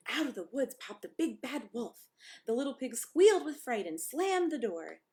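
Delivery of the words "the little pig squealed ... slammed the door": tense narration. A narrator's reading. Pig frightened by the wolf.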